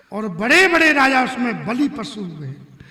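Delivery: loud, emphatic warrior's brag; menacing boast. Acted. Parshuram tells of kings he sacrificed in battle, loud and menacing. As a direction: boast forcefully to intimidate.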